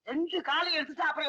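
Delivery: ordinary talk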